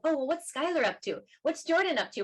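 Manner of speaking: retold motherly wondering, lifted pitch; curious mom. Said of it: "as a, as a mom".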